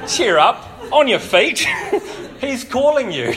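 reading it through real laughter; can't stop laughing at the line; crowd's mocking cheer, read with laughter. Real, not acted.

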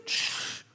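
sharp voiceless shush; hushing shh. Acted. God interrupting our worried venting, hushing. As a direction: cut in with a shush. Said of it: "how often God says to us".

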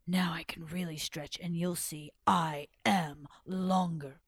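low, slowing snake boast; straining python. Acted. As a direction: draw it out low, as if stretching. Tied python boasts while stretching.

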